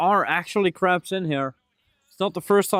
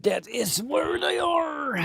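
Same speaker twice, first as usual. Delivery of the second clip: much higher and slow, drawn out; sing-song high; 12 semitones higher, slower than usual. Pretend. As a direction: croon it high and slow like a little tune. Repeats the line, drawn out.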